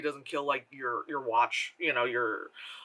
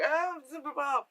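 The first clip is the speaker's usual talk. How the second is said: put-on dismissive ditzy girl voice; 11 semitones higher than usual. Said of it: "And then she's like".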